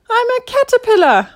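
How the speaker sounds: very high, loud little creature voice; indignant tiny caterpillar squeak. A narrator's reading. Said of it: "squeaked the eyebrow".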